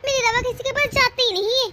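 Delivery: lightly sneering imitation of the elder daughter-in-law; mocking sister-in-law. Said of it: "और फिर कहती है".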